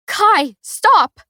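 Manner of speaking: very high, loud, breaking plea; anguished shriek; frantic scream. A narrator's reading. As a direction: scream his name to stop him. Pleading as he stabs himself.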